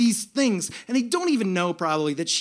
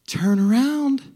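higher, louder cheery instruction; joyful angel; 5 semitones higher, 6 dB louder, slower than usual. Retold or imitated. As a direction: sing it out sweetly to the next rider. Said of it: "And she says".

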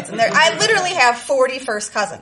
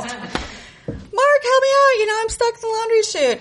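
high, drawn-out whiny plea; pleading kid; 11 semitones higher than usual. Retold or imitated. Trapped girl begs oldest cousin, high slow whine. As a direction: beg your big cousin for rescue. Begging cousin to pull her out.

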